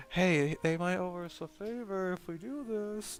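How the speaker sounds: soft, slow, hushed private suggestion; quiet conspiratorial hint; secretive aside. Shown as a demonstration. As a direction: murmur it like a secret plan. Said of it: "this would be something you'd whisper".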